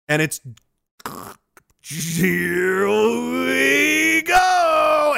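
a drawn-out, rising, very slow shout; gleeful shout. Pretend. Pushing the boulder off and yelling as it rolls, a long shout. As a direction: let the boulder go with a slow, building yell.

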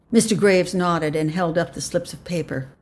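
ordinary delivery, nothing marked

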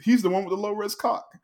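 sling it like a gleeful insult; catty mock jab. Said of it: "Yaz's like".

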